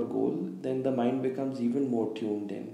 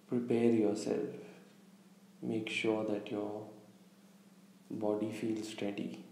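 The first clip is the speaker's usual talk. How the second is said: calm meditation guide, long pauses between phrases; unhurried, slightly hushed meditation delivery; guided visualization; 6 dB quieter than usual. Real, not acted. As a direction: lead listeners gently inward, leaving space after each instruction. Leads a guided visualization exercise.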